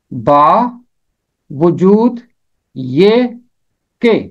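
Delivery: बा, वजूद, ये, के split apart with pauses between; teacher splitting the compound into its four words; broken into parts. Shown as a demonstration. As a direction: say बा, वजूद, ये, के as separate pieces. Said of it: "चार अल्फाज से मिलकर ये बना है".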